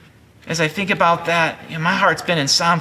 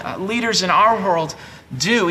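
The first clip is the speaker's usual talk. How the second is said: usual speaking voice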